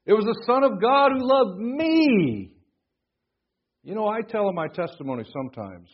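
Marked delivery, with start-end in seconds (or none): 0.1-2.5 loud, high build to a long "me"; stress on "me". Shown as a demonstration. Preacher making the verse personal, stress on "me". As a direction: proclaim it slowly, stretching "me" at the top. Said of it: "Forget about you for a minute".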